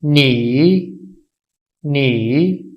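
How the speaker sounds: नी with a clear dip then rise; third tone demo. Shown as a demonstration. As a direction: drop नी first, then raise it. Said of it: "लफ्ज़ को पहले गिराया जाएगा". (नी पहले नीचे फिर ऊपर)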